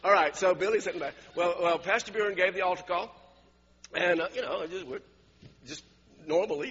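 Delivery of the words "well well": usual speaking voice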